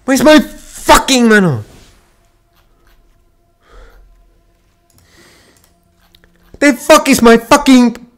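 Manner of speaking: high, loud exasperated scream; furious yell. Real, not acted. Gamer screaming at the game for mana, furious yell. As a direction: demand the mana in rage.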